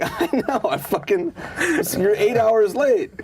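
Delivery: high, loud, incredulous scolding; mock exasperated complaint. Comic retelling scolding his very late friend, mock exasperation. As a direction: complain loudly, laughter creeping in.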